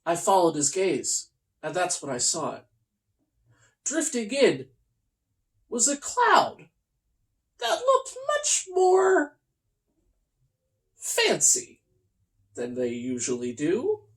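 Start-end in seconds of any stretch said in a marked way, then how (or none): 3.8-11.8 wondering. A narrator's reading. Narrator builds wonder.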